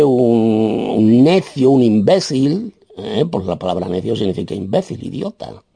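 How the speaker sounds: normal talking voice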